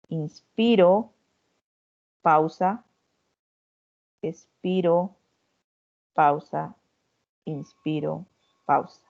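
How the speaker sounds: slow, drawn-out breathing cues with pauses. Shown as a demonstration. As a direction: count the breath out slowly like a coach. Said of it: "Esto es así". (pautas de respiración lentas y espaciadas)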